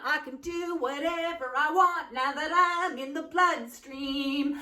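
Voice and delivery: high, slow sing-song parasite villain; hammed-up tiny germ gloating at its freedom; gleeful candida. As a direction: chant it high and drawn-out like an escaped microbe. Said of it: "candida dudes can, like".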